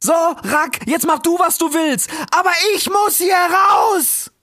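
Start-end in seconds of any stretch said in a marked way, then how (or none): 2.3-4.3 slow, desperate shout to get out; frantic cry of a trapped fox; panicked shout. Acted. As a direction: yell it as the flames close in. Fox panics in the burning tree.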